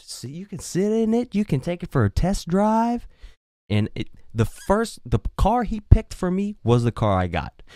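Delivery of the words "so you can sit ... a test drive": high, coaxing dealer voice; salesman pitch. Retold or imitated. Car dealer inviting the buyer on a test drive, high and coaxing. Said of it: "and go".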